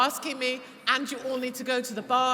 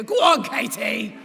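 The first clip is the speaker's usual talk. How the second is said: imitating street cheer; 2 semitones lower, 9 dB louder than usual. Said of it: "people stop in the street and go".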